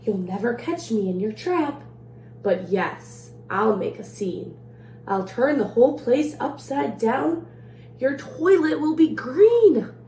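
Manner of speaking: lively storybook read-aloud in the leprechaun's rhymes; reading to children. A narrator's reading. Librarian reading a leprechaun rhyme to children, playful read-aloud. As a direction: read it like a bedtime story for kids. Reads picture book to children.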